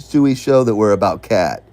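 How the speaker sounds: ordinary talk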